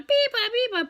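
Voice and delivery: high chirpy bird call; high mimicked birdsong; bird call imitation. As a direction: sing it like a little bird. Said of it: "another bird over there going".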